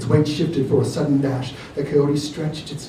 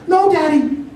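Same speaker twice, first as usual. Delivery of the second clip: high, loud plea; crying out; 7 dB louder than usual. A narrator's reading. Little girl crying out to her gun-holding father. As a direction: cry out to stop him. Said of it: "cried Celeste".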